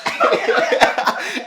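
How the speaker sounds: loud high burst of laughter; real laughter. Real, not acted. Storyteller laughing at her friend's stomach ache, loud real laugh. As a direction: crack up out loud.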